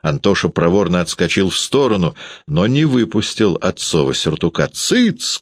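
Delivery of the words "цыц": very high-pitched hushing yelp; father's sharp cry. A narrator's reading. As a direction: silence the child in one high burst. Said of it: "крикнул Гудаевский".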